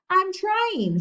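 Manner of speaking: put-on high, loud little-boy holler; boy hollering back to his brother. A narrator's reading. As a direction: yell it through the wall. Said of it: "Fudge hollered".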